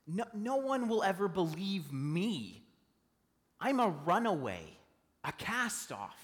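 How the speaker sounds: a lightly voiced Moses protesting; doubtful Moses. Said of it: "he says".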